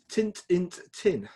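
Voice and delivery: clipped broad Sheffield "Tint, int, tin"; broad Sheffield accent. Retold or imitated. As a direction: say it like a Sheffield grandfather, lightly voiced. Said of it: "they spoke with a broad Sheffield accent".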